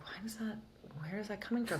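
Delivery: hushed, wondering whisper; whispering to herself about her dark urges; whispered self-question. Retold or imitated. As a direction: ask yourself under your breath. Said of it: "I'm like".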